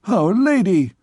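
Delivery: a sudden high exclamation; exclaiming. A narrator's reading. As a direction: blurt it out in alarm. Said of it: "exclaimed the king".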